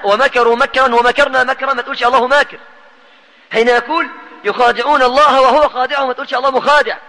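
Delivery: impassioned preaching. Acted. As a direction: lift the voice to drive the point home.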